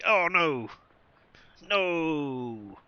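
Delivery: high, loud oh no; real alarm at the result; dismayed cry. Real, not acted. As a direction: gamer cries out at the cursed fedora. Reacts to cursed outcome.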